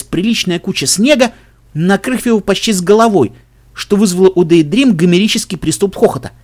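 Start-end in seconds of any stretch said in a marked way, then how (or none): none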